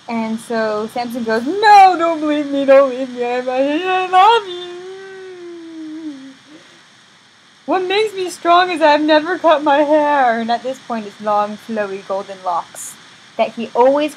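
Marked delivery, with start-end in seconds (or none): 1.4-10.4 high, loud begging that tumbles into a wail; play-acted tearful confession; mock desperate pleading. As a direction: beg your lover in hammed-up panic. Samson begs Delilah, acted.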